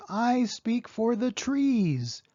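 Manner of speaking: slow, high, stressed plea for the trees; insistent Lorax. Acted. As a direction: lean on each word slowly. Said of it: "cried the Lorax".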